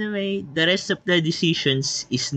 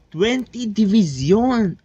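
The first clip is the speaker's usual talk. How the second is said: higher, louder, slow shocked exclamation; shocked count; 9 semitones higher, 5 dB louder than usual. Real, not acted. Gamer counting enemy divisions, stunned slow exclaim. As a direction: blurt out the total in disbelief.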